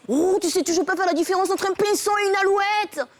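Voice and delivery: hammed-up husband scolding from on high; mock exasperation. Husband chides wife over birdsong, mock exasperation.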